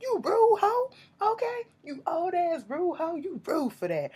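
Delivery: very high, shrill-voiced scolding with a whispered middle; sassy scolding. Scolding the catfisher in a very high sassy put-on voice. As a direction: scold him in a squeaky put-on voice. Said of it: "I said".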